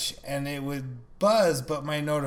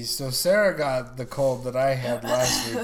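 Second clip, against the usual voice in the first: ordinary delivery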